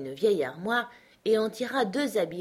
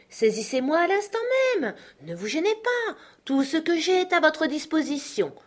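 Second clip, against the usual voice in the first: higher, ironic voice of a jovial uncle taunting his creditor; mocking uncle Benjamin; 5 semitones higher, 4 dB louder than usual. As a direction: egg him on, all sweet mockery. (oncle moqueur, voix plus aiguë et ironique)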